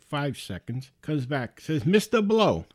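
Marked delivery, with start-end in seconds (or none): 1.9-2.7 high, loud mock address; scam caller's hail. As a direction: imitate the caller announcing himself back on the line. Scammer returns, calling his victim.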